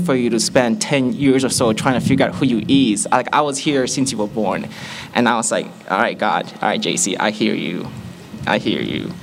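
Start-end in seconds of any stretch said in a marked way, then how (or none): none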